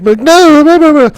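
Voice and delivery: high cartoonish blah-blah parody of wife's friend; mocking squeaky voice. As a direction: brush it off in a high silly parody. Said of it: "My wife's like".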